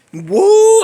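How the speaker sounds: loud, high "woo"; sudden hot-headed holler; high whoop. Real, not acted. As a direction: let out a fired-up whoop. Fired-up exclamation amid angry retelling.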